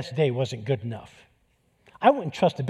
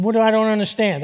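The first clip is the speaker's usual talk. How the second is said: louder, higher pointed question; pressing challenge; 6 semitones higher, 5 dB louder, faster than usual. Retold or imitated. Friend pressing the inmate who says he doesn't understand, loud challenge. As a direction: push back hard on him.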